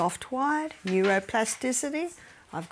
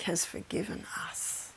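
much softer reverent whisper; 3 semitones lower, 11 dB quieter than usual. Acted. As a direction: drop to a hushed whisper. Reverent point about God's forgiveness.

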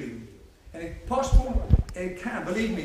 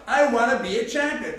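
shouted slogan; 3 semitones higher, 7 dB louder than usual. Shown as a demonstration. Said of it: "[name], shout out".

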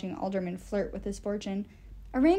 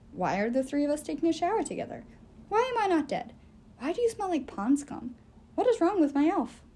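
bewildered dwarf; 6 semitones higher than usual. A narrator's reading. Silent questions voiced.

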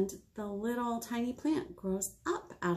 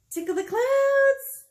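much higher and louder, slow and drawn out; excited call; 9 dB louder, slower than usual. Acted. Librarian leading kids in a stretch, high excited call. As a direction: stretch up and sing it out to the sky.

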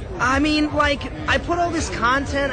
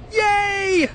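cheer; 10 semitones higher than usual. Real, not acted. Celebrating a life.

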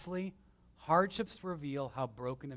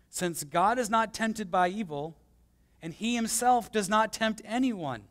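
scripture reading; 4 semitones higher, 3 dB louder than usual. Acted. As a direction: read the verse out to the congregation with weight. Reads scripture verse aloud.

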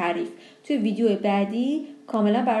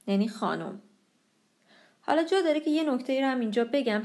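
usual speaking voice

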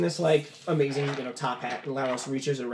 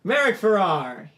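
loud, slow second call, raised pitch; 5 semitones higher, 6 dB louder, slower than usual. Acted. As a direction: call for the missing winner to step forward. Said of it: "He, like, calls".

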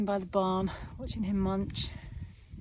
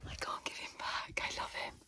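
whisper; 9 dB quieter than usual. Real, not acted. Besotted aside about the animal.

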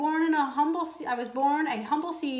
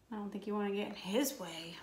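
hushed warning aside; whisper; 3 semitones lower, 8 dB quieter than usual. Real, not acted. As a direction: lean in and whisper it. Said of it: "reader aside whispered".

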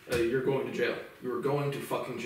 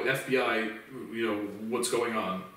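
imitating bored operator; 5 semitones lower than usual. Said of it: "they answer the phone like this".

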